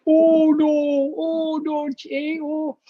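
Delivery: Canadian accent hammed up, high drawn-out O's; strong Canadian accent. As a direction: mimic co-stars' thick Canadian O's, high and stretched. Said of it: "has a really strong Canadian accent".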